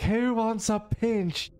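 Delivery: put-on high, slow leering drunk; drunk Saint Patrick voice. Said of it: "He's like".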